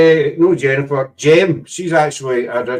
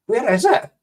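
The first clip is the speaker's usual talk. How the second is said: high, puzzled where-is-it; a high, baffled search for a tiny speck; mock puzzled squint; 9 semitones higher than usual. Scoffing at friend's tiny spider, a high puzzled question.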